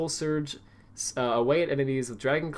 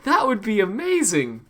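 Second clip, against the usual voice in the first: excited; 9 semitones higher, 6 dB louder, slower than usual. Real, not acted. Excited by his own game idea.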